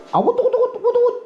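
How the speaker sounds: high-pitched woo-woo cheer; high excited whooping. Real, not acted. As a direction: squeal it out with joy. Cheering the result.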